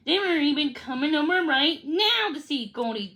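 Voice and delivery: plain reading voice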